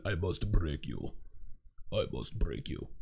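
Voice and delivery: very low, slow and soft villain voice; Ivan Drago. Acted. Host quoting a film villain to listeners, deep slow menacing impression. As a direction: intone it slowly, way down low. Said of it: "I'm getting an Ivan Drago t-shirt".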